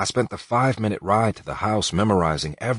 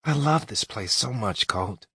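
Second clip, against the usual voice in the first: Allie pleading; 6 semitones higher than usual. Acted. Allie pleading to stay longer.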